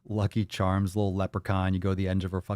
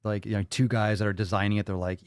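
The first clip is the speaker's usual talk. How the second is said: ordinary talk, nothing marked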